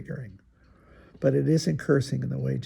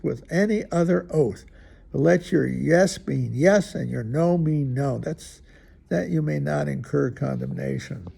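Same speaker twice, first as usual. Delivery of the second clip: measured, proclaiming reading of scripture; 2 semitones higher, 5 dB louder than usual. Acted. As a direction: read it out like a lesson in church. Reads scripture aloud.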